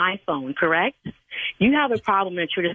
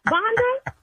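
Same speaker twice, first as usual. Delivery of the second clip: shocked. Real, not acted. Learns a friend set up the prank.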